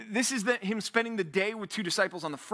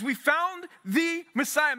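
excited; 6 semitones higher, slower than usual. Acted. Andrew announcing with eagerness.

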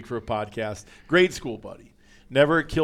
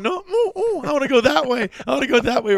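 high, loud mock doggy whine; imitating a buddy straining like a dog on a leash; whining dog; 12 semitones higher, 4 dB louder, faster than usual. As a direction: whimper like an eager dog straining to go, much higher and louder. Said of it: "He's like a dog going".

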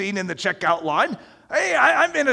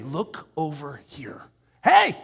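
tempting enemy calling; 5 semitones lower, slower than usual. Acted.

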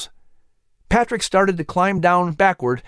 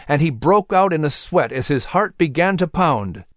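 ordinary delivery

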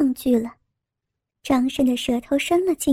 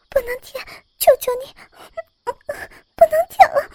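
much higher, breathless pleading cries; overwhelmed maid; 11 semitones higher than usual. A narrator's reading. Maid begging the scholar, high breathless pleading. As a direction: plead in high breaking gasps.

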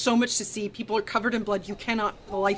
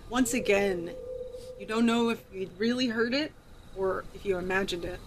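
ordinary talk

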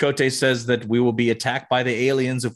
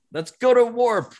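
emphatic order; 12 semitones higher than usual. Retold or imitated. Retold captain's order, repeated emphatically.

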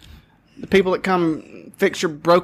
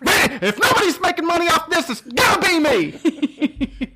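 mock shout; 11 semitones higher than usual. Real, not acted. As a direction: proclaim it with a laugh. Joking shouted claim.

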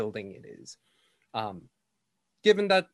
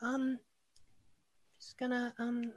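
put-on high, hesitant child voice; a caught kid stammering; shy little girl; 13 semitones higher than usual.

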